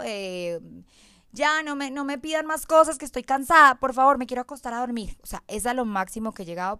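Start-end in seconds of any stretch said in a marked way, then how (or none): 1.3-5.2 much higher and louder exasperated outburst; retold yell. Exhausted mother yelling at family to stop asking, loud outburst. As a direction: shout it out like a worn-out mother snapping. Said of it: "lanzo un grito".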